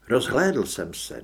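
ordinary delivery

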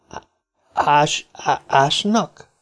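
halting, slow, frightened stutter; stammering in fright. A narrator's reading. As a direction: trip over the word, scared.

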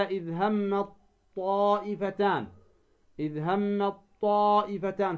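"إذ همت طائفتان" as "hammaṭ-ṭaa'ifatan", long aa; merged recitation. Shown as a demonstration. Teacher quotes scripture to show merging, ت into ط. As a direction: hold a heavy doubled ط from همت into طائفتان. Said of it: "تدغم التاء في الطاء".

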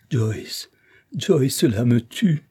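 understated despair, rising at the end; anguished plea. A narrator's reading. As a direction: plead as if it pains you. Isabelle in anguish confides in servant.

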